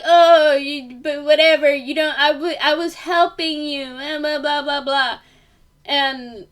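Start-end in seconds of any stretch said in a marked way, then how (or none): 0.0-5.2 higher, louder mock rant; angry coworker. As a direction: snap back at her like the coworker did. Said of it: "But she was all like".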